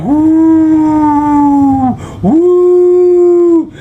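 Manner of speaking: long high rising whoo of wind, sustained; wind howl. Retold or imitated. Telling about the hurricane, imitating wind with a long howl.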